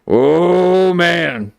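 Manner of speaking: high, drawn-out "Oh, man"; exasperated groan. Real, not acted. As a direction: wail it in worked-up exasperation. Exasperated rant, repeated as a wail.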